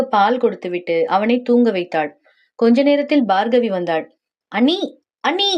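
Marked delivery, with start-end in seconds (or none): 4.5-5.5 rising calls to a sister-in-law; calling out. Acted. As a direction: call her as you come in, pitch swooping up. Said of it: "எதுக்கு இப்படி கத்திட்டு வர". (குரலை உயர்த்தி அழைப்பது)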